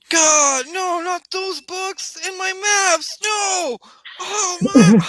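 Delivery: very high, drawn-out theatrical screaming; anguished scream. Acted. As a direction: wail in horror as your treasures burn. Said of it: "you hear Timmy scream".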